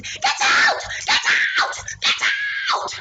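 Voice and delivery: very high, loud repeated scream; mother screaming the burglar out of her house; furious scream. Retold or imitated. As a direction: drive him out, screaming. Chasing intruder out, retold.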